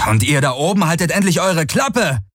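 furious bellow, pitched high; angry shout. Acted. Galen shouting up at the mocking crows, angry yell. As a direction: yell it up at the cawing birds.

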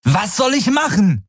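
high-pitched, exasperated cry; angry, desperate shout. A narrator's reading. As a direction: throw the question back in desperation. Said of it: "ohne seine eigene Wut oder Verzweiflung zu verhehlen". (wütend und verzweifelt gerufen)

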